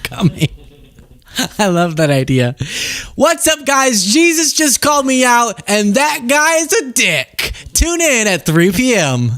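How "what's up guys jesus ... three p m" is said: perky, higher-pitched vlogger parody; Candace. Retold or imitated. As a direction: chirp the callout, drag out the sign-off. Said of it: "I love the idea of Candace".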